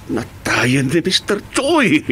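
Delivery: normal talking voice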